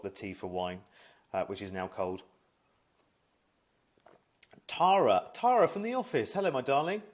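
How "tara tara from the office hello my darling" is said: high, loud call of a name; excited greeting. Real, not acted. Calling out to a viewer who just joined, excited and loud. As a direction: sing out the name to a viewer joining.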